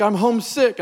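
high, lightly imitated mom; a hint of tearful mother; mom sounding terrible. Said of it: "Mom, you sound terrible".

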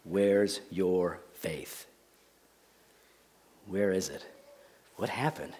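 low, soft questions with long pauses; tender rebuke. Acted. Jesus turning to his disciples, tender low rebuke. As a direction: rebuke them quietly, slowly, letting each question sink in. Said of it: "and then he rebukes them".

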